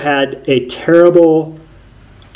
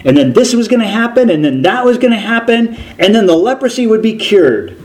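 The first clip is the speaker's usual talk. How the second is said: indignant complaint; 7 semitones higher than usual. Retold or imitated. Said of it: "So he's like".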